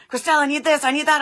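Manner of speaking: high, loud, insistent demands; pressing mock-frantic coworker voice; intense dispatcher. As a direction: fire off urgent orders in imitation. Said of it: "he was very, like, intense".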